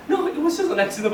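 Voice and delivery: put-on high, laughing protest of a crying kid; mock tearful denial. To his worried mother, a high, laughing, tearful denial. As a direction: brushes it off while still sobbing.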